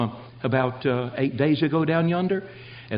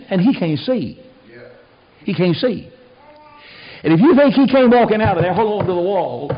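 loud, higher, emphatic preaching; full-force preaching; 5 semitones higher, 6 dB louder than usual. Real, not acted. Preacher explains the bound man's plight to the congregation, full-force preaching. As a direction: drive each point home loudly.

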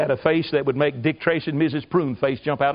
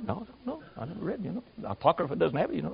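very soft, half-swallowed murmur; puzzled muttering; 2 semitones higher, 10 dB quieter, faster than usual. Retold or imitated. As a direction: mumble it like a baffled aside. Confused girls muttering among themselves.

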